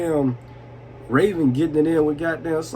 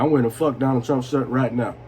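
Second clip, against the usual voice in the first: ordinary delivery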